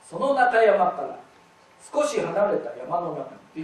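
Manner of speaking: one flowing phrase without a break; linked reading. Shown as a demonstration. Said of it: "私つなげて読みました".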